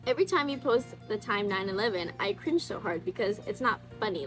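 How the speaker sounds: ordinary talk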